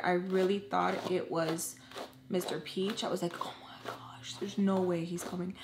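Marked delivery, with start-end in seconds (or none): 3.4-5.6 retold panicked whisper; scared whisper. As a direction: whisper it like you're hiding from someone. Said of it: "I was so scared".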